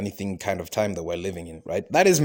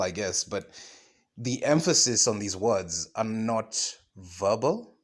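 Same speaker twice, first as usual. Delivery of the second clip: normal talking voice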